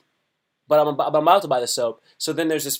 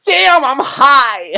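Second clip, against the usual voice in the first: high, loud, slow drawn-out yell; mock stoned shout; 9 semitones higher, 11 dB louder, much slower than usual. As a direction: howl it out like you're high. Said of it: "I drink it, I go".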